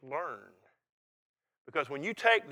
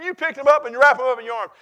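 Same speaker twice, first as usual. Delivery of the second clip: ordinary delivery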